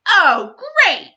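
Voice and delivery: mock the clumsy girl; sarcastic sneer. Bully kid sneering at Marcy.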